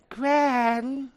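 high-pitched imitation British child; put-on British little-girl voice; little girl's British accent. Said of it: "little girl speaking in a British accent".

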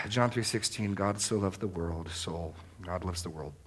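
low, soft, trailing away; understated recitation. Shown as a demonstration. Preacher showing what preaching to yourself is not, understated and soft. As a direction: mutter the verse as if by rote. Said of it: "This is more than simply".

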